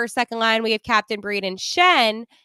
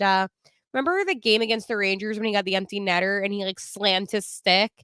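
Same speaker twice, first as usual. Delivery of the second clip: ordinary talk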